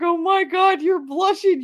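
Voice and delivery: high-pitched imitation of gushing; mock delighted teasing. Co-worker teasing her for blushing, mock delighted.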